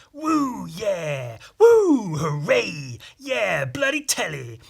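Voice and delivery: high, loud whooping cheers; mock cheering at the telly; imitating a cheering clip. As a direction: whoop it up like a gleeful heckler. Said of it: "clip of Charlie Brooker going".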